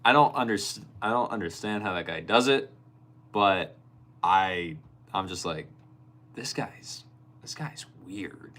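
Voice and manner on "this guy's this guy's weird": whispered, then slow and soft; under-the-breath verdict. Retold or imitated. Streamer's private thought about a weird guy, whispered aside. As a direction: mutter it to yourself, half whispered.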